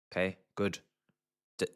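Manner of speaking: ordinary talk, nothing marked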